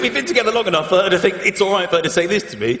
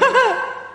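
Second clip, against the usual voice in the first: imitated laugh snort. Comedian playing a laugh snorter caught out, snorting. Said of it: "You forget you're a laugh snorter".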